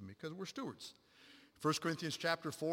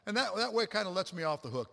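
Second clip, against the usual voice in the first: normal talking voice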